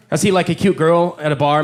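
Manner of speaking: ordinary talk, nothing marked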